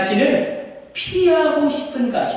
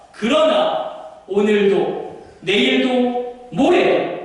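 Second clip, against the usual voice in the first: resolute; 6 semitones higher, slower than usual. Acted. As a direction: declare it with no turning back. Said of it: "단호합니다".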